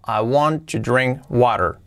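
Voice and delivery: frase inglesa lenta y clara; inglés modelo. Shown as a demonstration. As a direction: pronuncia despacio como profesor. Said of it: "Quiero beber agua". (modelo de pronunciación lenta)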